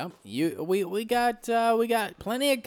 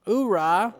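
gung-ho soldier cry; 4 dB louder than usual. Acted. Navy seal battle cry.

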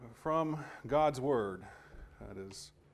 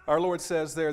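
ordinary delivery

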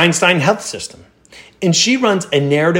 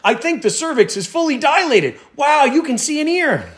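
high-pitched mock commentator calling a birth; excited sportscaster parody; play-by-play; 11 semitones higher than usual. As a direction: call it like a thrilling game, pitched way up. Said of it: "is it like play by play?".

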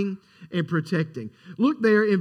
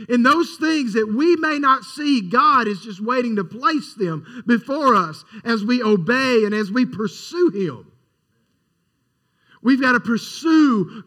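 rising, louder, fervent sermon voice; preaching at full force; 3 semitones higher, 3 dB louder than usual. Acted. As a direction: preach it with growing intensity to the congregation.

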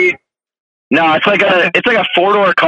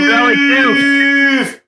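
high, long-held announcer call; slow, high-pitched mock rally announcement; radio voice imitation; 10 semitones higher, much slower than usual. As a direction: draw it out slowly like an emcee on the mic. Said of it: "That dude's got the radio voice".

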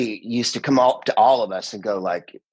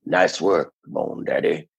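laid-back hipster, sinking lower at the end; 5 semitones lower, slower than usual. Acted. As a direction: play it as a low-key hipster. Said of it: "So I did the hipster".

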